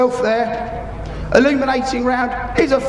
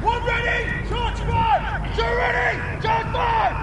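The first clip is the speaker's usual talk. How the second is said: high, far-off shouted replies; distant shouted reports; 11 semitones higher, 5 dB quieter, slower than usual. Real, not acted. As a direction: bark the reports back across the field. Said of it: "They will be shouting, though".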